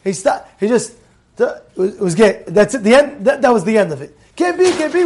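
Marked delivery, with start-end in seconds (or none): 4.4-5.0 high-pitched mock protest; quick raised-voice refusal; retold screaming. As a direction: imitate an objector insisting loudly. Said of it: "he was screaming and screaming and screaming".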